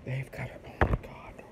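very soft, near-whispered dismay; hushed aside. Real, not acted. Aside after she hung up, hushed dismay. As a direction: say it low, almost to yourself.

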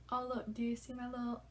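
ordinary talk, nothing marked

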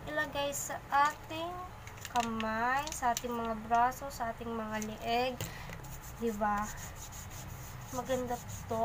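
face mask limits cheek movement; speaking through a mouth opening; talking with a sheet mask on. Real, not acted. Seen 1: Wet sheet mask covers face; mouth opening leaves lips free.

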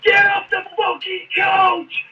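very high, strained imitated scream; screaming sergeant. Drill sergeant driving recruits off the coach, imitated screaming. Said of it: "he's screaming and shouting".

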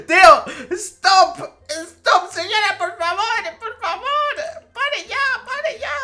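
high, loud, laughing begging; laughing pleas. Real, not acted. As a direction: beg her to stop, half laughing. Pleading with chasing player.

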